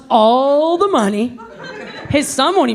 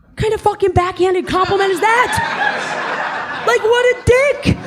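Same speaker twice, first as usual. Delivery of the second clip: mock outrage; 7 semitones higher than usual. Real, not acted. Outraged punchline to crowd.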